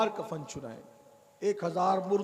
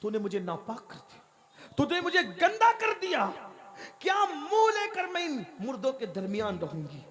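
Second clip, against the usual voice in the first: screaming curse; 3 semitones higher than usual. Acted. Dead girl shrieks a curse at the shroud thief. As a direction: scream it out, wounded and furious. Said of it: "उसने चीख मारी".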